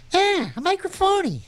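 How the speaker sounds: sudden high squeaky jab at himself; mock high squeak. DJ teasing himself in a high squeaky voice. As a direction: squeak it out like a goofy sidekick. DJ mocks his own title.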